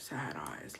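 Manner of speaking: low, whispered emphasis; hushed sympathy. Real, not acted. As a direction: sink into a sorrowful whisper. Dwells sadly on the widow's eyes.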